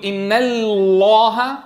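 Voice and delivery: very slow "إن الله", lam begun thin and finished thick; questioning demo of "إن الله", stretched light-to-heavy lam; light-then-heavy lam. Shown as a demonstration. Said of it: "أبدأ بلام مرققة ثم مفخمة".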